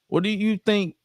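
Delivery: high teasing question; mocking taunt. Bradshaw taunting.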